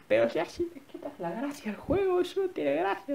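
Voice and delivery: high mock-objecting viewer; imagined viewer voice. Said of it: "Y dirás".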